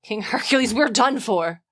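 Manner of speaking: Xanthias, the sly slave, teasing. Acted. Reading Xanthias to the frightened Dionysus, hammed-up and excited. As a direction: play the servant spooking his master for fun.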